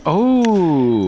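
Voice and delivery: sharp, high excited "oh"; excited gasp. Real, not acted. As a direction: react to a near miss. Reacting to a near eagle.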